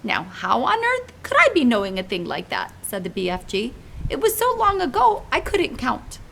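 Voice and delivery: big friendly giant pleading gently; giant's voice. Acted. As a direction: play the kindly muddled giant. BFG answers Sophie.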